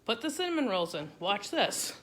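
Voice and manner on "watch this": ordinary talk, nothing marked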